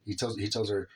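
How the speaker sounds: usual speaking voice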